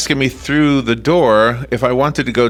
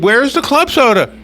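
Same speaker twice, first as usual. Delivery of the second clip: higher-pitched mock irritation; mildly indignant, pitched up; retold annoyance; 7 semitones higher than usual. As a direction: imitate a cross sleepwalker demanding an answer. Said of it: "He was so mad".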